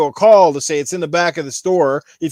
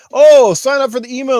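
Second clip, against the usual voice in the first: mocking a clerk; 7 semitones higher, 8 dB louder than usual. Mocking store's advice.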